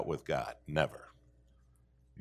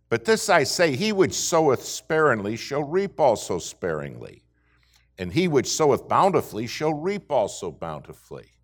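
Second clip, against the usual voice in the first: scripture reading; 5 semitones higher, 6 dB louder than usual. Acted. Reads scripture at full force.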